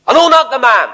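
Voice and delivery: high, loud cornered denial. Retold or imitated. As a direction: fling out the denial like a trapped man. Peter denying Christ, retold.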